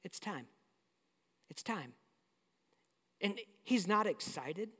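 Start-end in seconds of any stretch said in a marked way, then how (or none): 0.0-1.9 much softer and lower, at peace; calm resigned. Retold or imitated. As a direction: say it quietly, accepting what comes. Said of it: "he goes".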